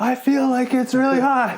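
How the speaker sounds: very high, laughing mock-distressed reply; mock protest. Exhausted athlete answering the coach, high mock protest. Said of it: "I'm like".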